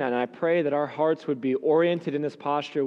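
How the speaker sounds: ordinary talk, nothing marked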